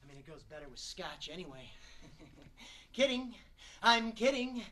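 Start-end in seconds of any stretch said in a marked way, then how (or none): 0.0-1.7 low, soft and quick; offhand aside. Acted. Smoker conceding after boasting of willpower, muttered aside. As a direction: toss it off under your breath, quickly.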